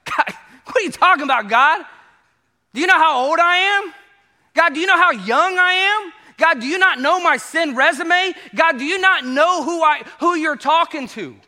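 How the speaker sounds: high, loud disbelief at God; incredulous. Retold or imitated. Listener doubting God's call, high loud disbelief. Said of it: "You're like".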